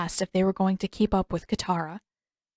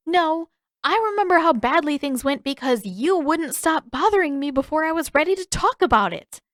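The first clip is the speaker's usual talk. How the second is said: put-on angry girl; 8 semitones higher than usual. A narrator's reading. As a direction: turn the blame back on him. Sister fires back.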